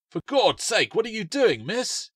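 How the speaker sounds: high, loud alarmed yell; a park worker yelling at a girl in danger; alarmed shout. Acted. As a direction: warn her off, startled.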